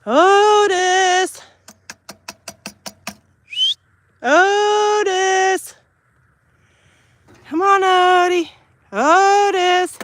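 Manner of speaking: long high loud call across the yard; yelling for the dog. Real, not acted. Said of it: "I have to yell loud so he knows".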